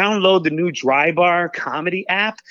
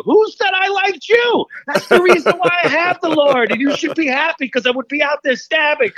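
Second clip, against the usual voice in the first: much higher pitched, worked-up defiance; emotional; 12 semitones higher than usual. Real, not acted. Comic telling the host about lifelong critics, worked up and high. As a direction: lets it spill out half laughing. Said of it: "I don't mean to get emotional".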